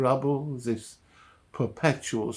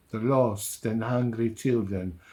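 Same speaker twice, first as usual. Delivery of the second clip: usual speaking voice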